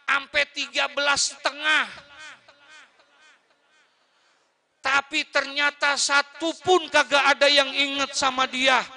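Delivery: high, loud Betawi-tinged sermon build; fiery preaching. Acted. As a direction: hammer the neglectful children. Preacher rising to full force. (khotbah berapi-api dengan suara tinggi)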